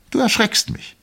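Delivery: wife complains he frightened her, very high; wife's voice. A narrator's reading.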